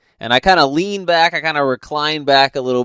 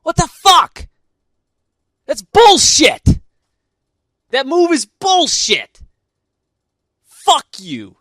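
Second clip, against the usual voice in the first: mock gamer rage; 9 semitones higher, slower than usual. Gamer cursing at the TV over a bad move, mock rage. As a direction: yell at the game like it cheated you. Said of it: "You're like / cussing at the T V".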